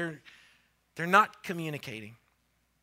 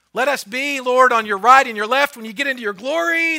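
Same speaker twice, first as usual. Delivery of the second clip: higher and much louder pleading; eager plea; 7 semitones higher, 15 dB louder than usual. Acted. Disciples begging Jesus for glory, loud eager plea. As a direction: beg loudly for the best seats.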